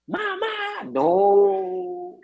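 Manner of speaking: high, long-drawn mock wail; imitated labor scream. Doctor mimics a patient pushing in labor, wailing scream. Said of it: "comienza a gritar".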